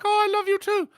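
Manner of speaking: high sweet wife voice; high lady voice. Retold or imitated. Wife cooing to husband in a high voice. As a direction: coo back like a lady. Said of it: "I don't know why I did it that way".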